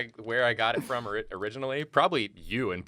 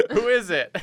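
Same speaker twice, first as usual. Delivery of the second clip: high voice through laughter; laughing high voice; 10 semitones higher, 6 dB louder than usual. Retold or imitated. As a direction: squeak it out laughing. Imitating feverish Julia.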